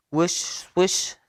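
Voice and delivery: usual speaking voice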